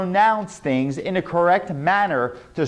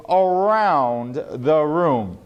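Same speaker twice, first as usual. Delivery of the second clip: slow, carried-out "around the room"; drawn-out exercise delivery; much slower than usual. Shown as a demonstration. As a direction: stretch AROUND out long and slow. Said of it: "write it down and say".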